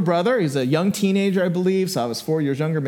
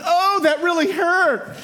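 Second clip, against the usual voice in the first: high, slow, exaggerated fake hurt; mocking; 11 semitones higher, slower than usual. Brother teasing mom after a love tap, mocking. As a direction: taunt with a drawn-out pretend ow. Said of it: "mocking her".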